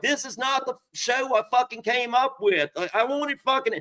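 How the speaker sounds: high-pitched mock fury; Ty Murray. Bull rider fuming over his ruined show, imitated fury. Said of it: "Ty Murray's furious".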